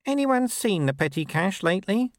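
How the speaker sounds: very high, mild question; high-pitched tentative recruit; timid constable. A narrator's reading. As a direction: ask it innocently, high up. Timid constable asks the room.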